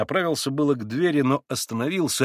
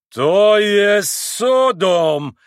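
loud, slow, high bellow; outraged drawn-out roar; 7 semitones higher, 7 dB louder, much slower than usual. A narrator's reading. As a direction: roar it with long-drawn vowels. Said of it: "взревел вдруг пан Врублевский".

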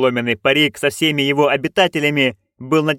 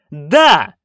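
loud shout; 3 semitones higher, 6 dB louder than usual. A narrator's reading. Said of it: "witches shout yes".

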